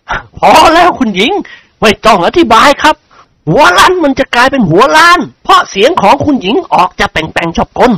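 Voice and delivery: lightly voiced fussy lord; interrupting lord character. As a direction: play a nobleman stopping her chatter.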